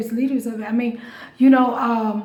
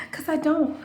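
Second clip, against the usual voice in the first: ordinary talk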